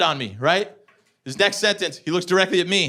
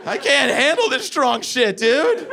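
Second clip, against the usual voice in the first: ordinary talk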